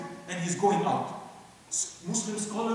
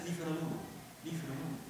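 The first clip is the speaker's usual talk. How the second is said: quiet command; 4 semitones lower, 14 dB quieter, faster than usual. Retold or imitated. Sultan calmly protects the woman.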